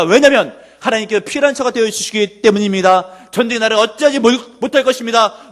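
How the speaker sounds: full-force preaching. Real, not acted. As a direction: drive each declaration home to the congregation. (힘차게 외치는 설교조)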